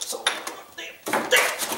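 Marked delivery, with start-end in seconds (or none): none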